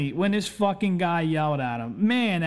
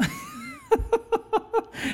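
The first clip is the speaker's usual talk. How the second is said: a sudden high burst of laughter. Real, not acted. Telling a funny work story.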